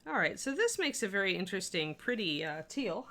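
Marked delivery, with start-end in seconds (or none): none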